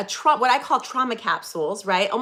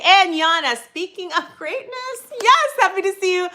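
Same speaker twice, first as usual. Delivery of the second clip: excited greeting; 8 semitones higher, 6 dB louder than usual. Real, not acted. Greeting viewer.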